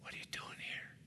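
breathy whisper of God, barely audible; hushed divine whisper; gentle whisper. Acted. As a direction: whisper it like a still small voice. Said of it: "the Lord said in that same voice".

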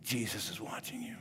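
eerie breath-only whisper; very soft horror-movie whisper. Acted. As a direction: whisper it like a voice in the dark. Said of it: "he heard it again".

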